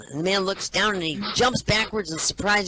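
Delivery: ordinary talk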